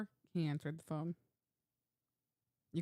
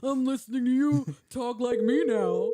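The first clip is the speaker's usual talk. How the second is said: louder, slower gruff tough guy; imitating Ben; 4 semitones higher, 11 dB louder than usual. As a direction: mimic the angry caller. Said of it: "where you came up with that one".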